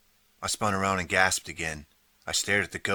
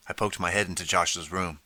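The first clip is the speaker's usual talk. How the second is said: ordinary delivery, nothing marked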